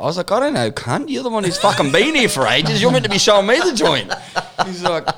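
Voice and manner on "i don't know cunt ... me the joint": loud, high-pitched exasperated comeback; mock indignant. Telling off the mate meant to show him round, mock indignant. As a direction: throw the blame back, half laughing. Acted quarrel between lost mates.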